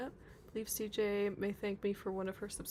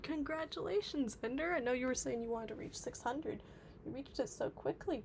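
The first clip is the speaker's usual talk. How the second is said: ordinary talk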